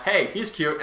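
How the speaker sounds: ordinary talk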